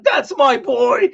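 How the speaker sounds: high, loud, slow boastful dad; hammed-up proud father. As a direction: savor it like a dad bragging about his boy. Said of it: "Some dads would be proud of that moment and be like".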